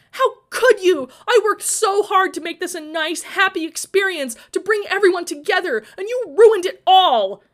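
high, very loud accusation; upset yell near tears; tearful outburst. A narrator's reading. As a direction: round on him, crying out. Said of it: "Glory rounded on her brother".

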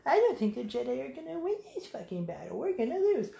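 lightly voiced clone trooper complaining; mock trooper voice. Podcaster mimicking a doomed clone, quiet grumble.

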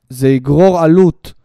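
low, slow, loud machine announcement; robotic ATM voice. Acted. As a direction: deliver it like a cold cash machine. (כספומט רובוטי מודיע בקול נמוך ואיטי)

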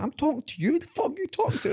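high-pitched mock squaring up; retold confrontation. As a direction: square up drunkenly, voice shooting up high. Retold drunken face-off with bodybuilder.

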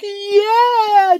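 high, loud whoop of glee; excited cheer. Retold or imitated. Kid cheering an exploding Coke bottle, high loud whoop. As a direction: cheer like the bottle just blew. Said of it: "You're like".

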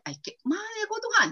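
high whiny complaint; mock whining complaint. Frustrated young man complaining to God, high and whiny.

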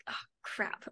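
voiceless whisper under the breath; self-scolding whisper; whispered oh crap. Retold or imitated. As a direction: mutter it, caught out. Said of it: "I'm like".

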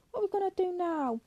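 high, quick pleading question falling at the end; desperate plea. A narrator's reading. Candy presses George for an answer, desperate. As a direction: plead it high and fast, voice lifted throughout.

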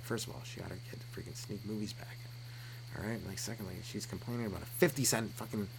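very soft, confidential undertone; whispering. Acted. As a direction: murmur a complaint privately to the manager. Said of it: "so I'm whispering to Jerry".

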